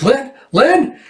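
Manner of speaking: high, loud frantic call of a name; desperate high-pitched cry for help. Acted. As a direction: yell for your friend in panic. Friend yelling as monsters attack.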